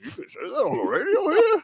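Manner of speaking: high-pitched mock disbelief; imitating incredulous listener. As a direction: lightly mimic a shocked, high-pitched question. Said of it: "He goes".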